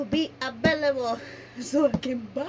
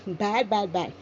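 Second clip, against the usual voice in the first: ordinary delivery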